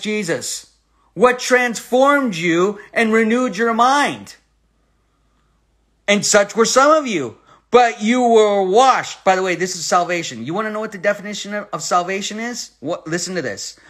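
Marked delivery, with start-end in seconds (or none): 6.1-9.2 slow and swelling on washed; rising pulpit reading. Acted. Preacher reading the verse to the church, slow and swelling. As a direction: build it toward washed.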